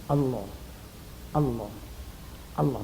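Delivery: soft whisper. Shown as a demonstration. Preacher whispering God's name to the dying. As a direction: murmur the name gently, over and over. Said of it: "pendekkan lagi".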